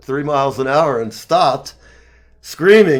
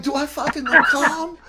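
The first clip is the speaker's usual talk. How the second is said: high, exasperated mock retort; incredulous retort; 12 semitones higher than usual. Son answering military dad, high incredulous retort. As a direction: snap back in disbelief. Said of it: "I'm like".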